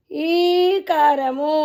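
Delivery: ఈకారము with the ఈ held long and slow; long ii sound. Shown as a demonstration. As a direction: draw out the ఈ of ఈకారము. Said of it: "అనండమ్మా". (ఈకారము లో ఈ దీర్ఘంగా సాగదీయండి)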